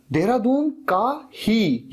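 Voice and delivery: "ही" हल्का ज़ोर देकर, धीरे खींचकर; slow "देहरादून का ही", lean on "ही"; stress on ही. Shown as a demonstration. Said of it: "ये जो ही वर्ड आया". ("ही" पर ज़ोर)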